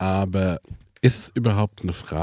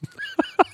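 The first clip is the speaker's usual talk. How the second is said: laugh. Real, not acted. Laughs after confession.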